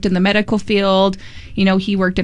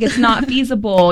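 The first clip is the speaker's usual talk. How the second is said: ordinary delivery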